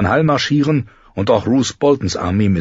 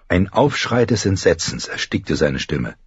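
plain reading voice

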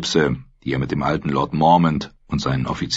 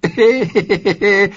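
high amused giggle; a short, high chuckle; 5 dB louder than usual. Acted. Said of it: "Mace Tyrell kicherte".

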